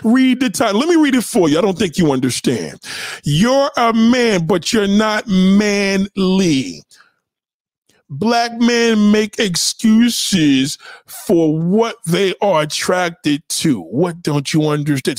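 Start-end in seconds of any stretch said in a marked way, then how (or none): 3.2-13.8 every word dragged out slowly for a confused reader; slow it right down, word by word; very slow, spelled out. Shown as a demonstration. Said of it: "if I say it any slower than that".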